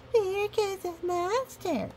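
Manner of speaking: put-on high, slow, startled child pointing; a child gasps at the passing monster; child exclaiming. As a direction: call it out like a wide-eyed kid. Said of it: "you exclaim when I pass by".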